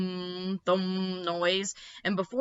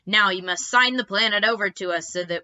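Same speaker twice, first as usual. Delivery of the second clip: louder, menacing alien voice; gloating aliens; 2 semitones higher, 8 dB louder than usual. Acted. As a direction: lord it over captives.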